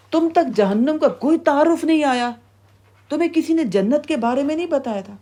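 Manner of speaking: high, insistent incredulous questions; interrogating gatekeeper. Acted. Keeper of Hell asking if guidance never reached them, incredulous interrogation. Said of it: "جہنم کا داروغہ کہے گا".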